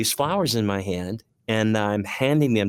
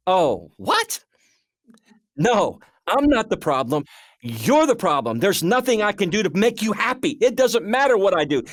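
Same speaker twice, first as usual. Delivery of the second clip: high-pitched frustrated protest; angry, frustrated; 10 semitones higher than usual. Retold or imitated. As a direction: re-enact a heated marital quarrel, pitch way up. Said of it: "we were angry and frustrated inside".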